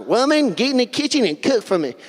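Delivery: derisive, dismissive man. Acted. Preacher parodying how some hear the word woman, derisive. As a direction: put on a bossy, derogatory caricature voice. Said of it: "derisive, derogatory, like, dismissive term".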